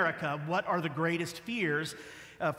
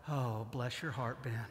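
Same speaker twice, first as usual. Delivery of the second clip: gently patronising self-talk; 6 semitones lower, 6 dB quieter than usual. Retold or imitated. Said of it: "think to myself".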